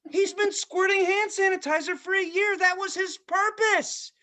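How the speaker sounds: high-pitched outraged plea; comic distress. Real, not acted. Comic outcry over jobless kid.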